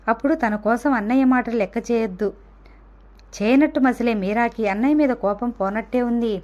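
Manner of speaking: ordinary delivery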